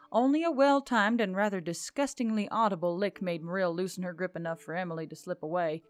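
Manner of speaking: ordinary delivery, nothing marked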